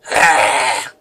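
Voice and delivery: low rasping zombie growl; zombie snarl. A narrator's reading. Zombies unfreeze, confused but snarling. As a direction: snarl like the undead. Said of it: "looking confused but snarling".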